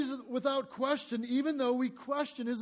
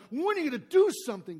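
louder, quick demand; fist-shaking challenge; 4 semitones higher, 7 dB louder, faster than usual. Acted. Habakkuk on the rampart challenging God, angry. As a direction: shake your fist at the sky. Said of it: "shaking his fist at God".

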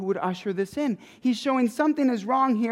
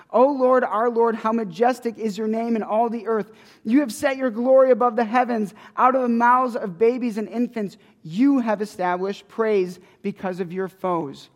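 psalm reading; 2 semitones higher, 4 dB louder than usual. Acted. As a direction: lift the psalm up in praise.

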